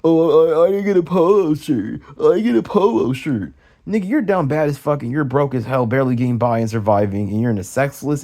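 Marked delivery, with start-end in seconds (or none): 0.0-3.5 loud whiny mock of his dad. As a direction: sneer at the dad's petty complaint.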